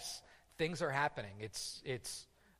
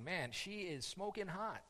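inner thought; 4 semitones higher, 9 dB quieter, slower than usual. Retold or imitated. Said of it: "looking at his wife and thinking".

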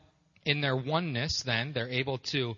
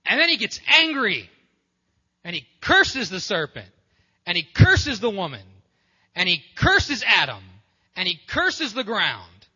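much higher and louder, hammering each "curses"; rising fervor; 6 semitones higher, 10 dB louder than usual. Acted. Preacher telling the congregation God cursed all, loud.

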